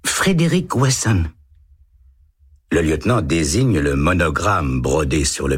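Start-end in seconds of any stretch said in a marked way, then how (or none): 0.1-1.2 put-on higher, timid little boy's voice; a small boy answering a policeman shyly. A narrator's reading. Eight-year-old boy answers the lieutenant, timid child voice. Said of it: "dit Tommy".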